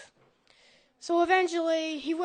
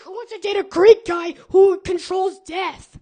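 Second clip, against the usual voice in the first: loud emphatic; 3 semitones higher, 12 dB louder than usual. Real, not acted. Punchline to the crowd.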